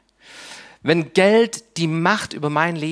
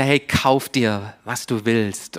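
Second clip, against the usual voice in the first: lower, slow, coaxing voice of money; money whispering temptingly; 7 semitones lower, slower than usual. Acted. Preacher voicing money tempting the listener, low and slow. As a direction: tempt like money murmuring in your ear. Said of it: "Geld flüstert".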